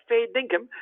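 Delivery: high, doubtful Aussie query; questioning fair dinkum. Shown as a demonstration. Buyer haggling with opal dealer, doubtful query. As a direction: ask if that's really his best price. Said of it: "a question where you're kind of saying".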